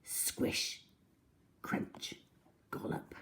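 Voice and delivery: breathy, drawn-out whispered crunch noises; whispered sound words. A narrator's reading. Crocodile gloating over eating children, whispering. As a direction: savour each chomp in a whisper. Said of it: "kept as quiet as a mouse".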